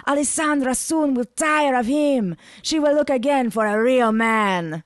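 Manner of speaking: slow, high Italian seducer; Italian accent. A narrator's reading. Ivan voiced, intimate boast.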